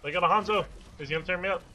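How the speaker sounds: loud, high alarmed callout to teammates; excited callout. Real, not acted. Gamer shouting to teammates as enemy appears, excited alarm. Spotting enemy Hanzo mid-match.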